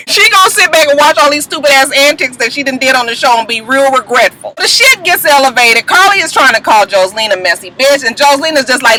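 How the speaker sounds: ordinary talk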